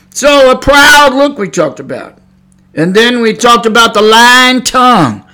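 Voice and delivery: high, loud pulpit delivery; impassioned, shouted sermon; full-force preaching. Real, not acted. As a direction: hammer the warning home like a preacher.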